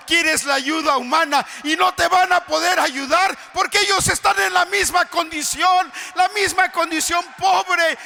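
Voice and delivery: loud, high fervent preaching; preaching at full force. Acted. Preacher urging a brother in the pews, fervent preaching. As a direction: pound it home to the congregation.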